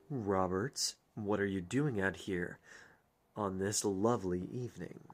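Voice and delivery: low soft mock suave; sly greeting. Character greets Robert on the evening walk, low sly greeting. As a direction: purr the question to him.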